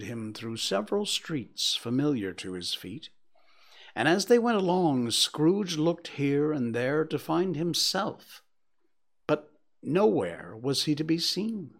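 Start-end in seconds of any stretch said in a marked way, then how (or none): none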